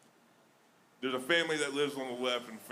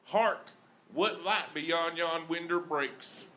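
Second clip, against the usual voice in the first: put-on Southern-accented Shakespeare; 6 semitones higher than usual. Comic performing Shakespeare for a crowd, exaggerated Southern accent. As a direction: declaim it with a big Southern twang. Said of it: "Southern accent".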